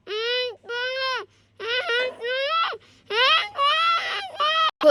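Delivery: muffled wordless humming; gagged moans. Acted. Sonia gagged by the thief, muffled moans. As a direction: try to cry out through a gag. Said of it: "Sonia कुछ भी नहीं बोल पा रही".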